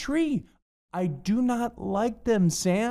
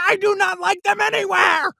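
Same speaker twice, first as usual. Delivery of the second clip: exasperated shout; 12 semitones higher, faster than usual. Acted. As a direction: blow up and yell the refusal fast. Refusal climax in the rhyme.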